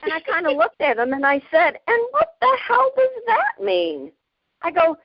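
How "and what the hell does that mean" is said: high, squealed mock outrage; mock indignant. She snaps back at a customer in mock outrage. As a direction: play up the offended squeal.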